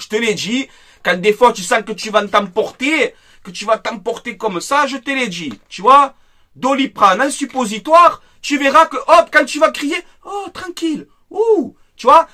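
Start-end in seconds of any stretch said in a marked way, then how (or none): 5.7-12.3 sarcastic, high, sing-song advice; mock soothing. Mocking Riolo as if calming a tantrum, high sing-song. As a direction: talk down to him like a child needing calm. Mocks Riolo, suggests suppository.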